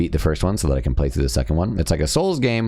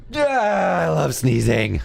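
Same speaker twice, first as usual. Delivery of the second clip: excited shout; 13 semitones higher, much slower than usual. Real, not acted. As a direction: yelp it out gleefully. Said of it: "I get a little excited when I sneeze".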